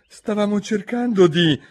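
high, anxious, cut off; nervous plea. Acted. Il giardiniere si giustifica, spaventato. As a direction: stammer out an excuse. Gardener pleads.

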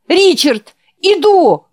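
high, loud urgent call; shouting. Acted. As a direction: call out across the hall. Jack calls down to dying friend.

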